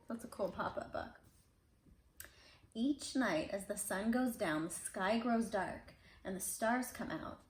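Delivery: usual speaking voice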